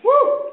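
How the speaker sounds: very high, quick "woo"; exultant whoop. Real, not acted. Preacher rejoicing at being a survivor, exultant whoop. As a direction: let a high whoop fly.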